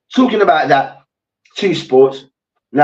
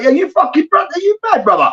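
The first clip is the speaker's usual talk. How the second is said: mocking taunt; 9 semitones higher, faster than usual.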